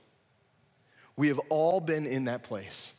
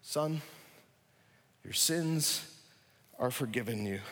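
Jesus speaking softly to the paralysed man; tender; 4 semitones lower, 6 dB quieter than usual. Retold or imitated. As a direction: say it gently, letting it land. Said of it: "the very first thing that Jesus said".

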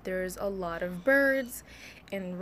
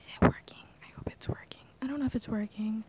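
whisper; 3 semitones higher than usual. Real, not acted. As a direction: whisper close to the new mic.

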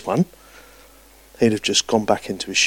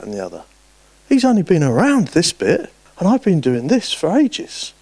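much higher, whining mock voice; whiny jealous complainer; 8 semitones higher than usual. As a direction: imitate a petty, envious believer. Mimics jealous churchgoer.

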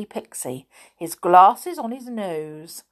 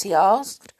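usual speaking voice

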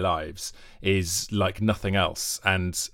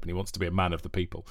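ordinary talk

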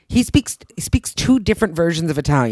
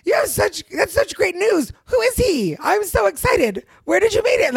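hammed-up sisterly enthusiasm; badly acted excitement. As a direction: overdo the delight like a bad actress. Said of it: "doing a terrible job of acting".

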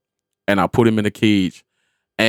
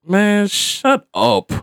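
higher, drawn-out irritated brush-off; retold irritation; 7 semitones higher, much slower than usual. Stepfather already mad at his stepdaughter, retold irritation. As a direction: stretch it out, fed up. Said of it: "I'm already mad".

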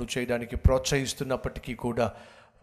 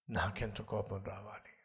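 repeated much softer and lower; quiet echo; 5 semitones lower, 11 dB quieter than usual. Retold or imitated. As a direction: drop to a grave hush. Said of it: "ఇప్పుడు దేవుడు చెప్పాడు". (మెల్లని గంభీర స్వరం)

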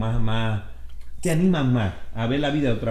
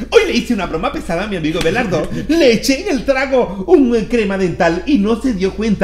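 TikTok influencer voice; 7 semitones higher, 5 dB louder than usual. Pretend. Mocking a TikTok prank video voice.

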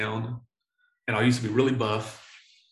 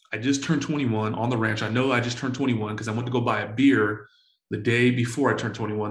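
usual speaking voice

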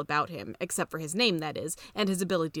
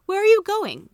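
much higher, much louder call after him; 13 semitones higher, 11 dB louder than usual. A narrator's reading. Mother calling after the bear leaving the house, loud high call. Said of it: "called Missus Brown".

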